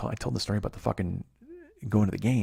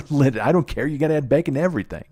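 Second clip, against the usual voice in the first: excited rant; 6 semitones higher, 6 dB louder than usual. Retold or imitated. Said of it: "I go".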